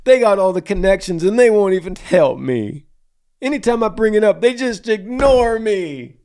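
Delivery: high, whiny mock complaint, pitched well up; whiny kid voice. Host mocks the young caller's email as a whining child. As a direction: whine it like a sulking little boy. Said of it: "it sounds like you're saying".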